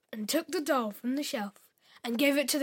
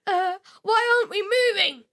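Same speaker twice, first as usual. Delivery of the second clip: put-on high, fast worried girl; rising panic, fast and high; panicked girl; 7 semitones higher, 4 dB louder, faster than usual.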